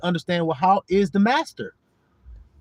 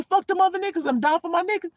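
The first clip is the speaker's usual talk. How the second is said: high-pitched quote; 10 semitones higher, faster than usual. Retold or imitated. Quotes the teammate's pledge.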